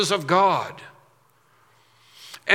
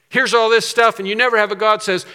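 usual speaking voice

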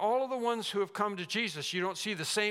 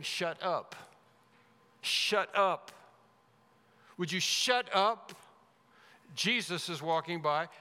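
emphatic stern rebuke, punched in bursts; stern disapproval; 2 semitones higher, slower than usual. Acted. Crowd silencing a blind beggar, sternly disapproving. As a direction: scold with strong disapproval, stretching the key words. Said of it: "they were sternly saying to him".

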